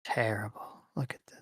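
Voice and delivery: soft whispered aside. Real, not acted. Reading donations to the group in a hushed whisper. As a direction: lean in and whisper it.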